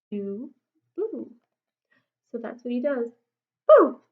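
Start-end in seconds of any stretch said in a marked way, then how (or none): 0.1-1.3 soft echo boo. Acted. 3.7-4.0 high, loud scary BOO; hollered boo. Acted.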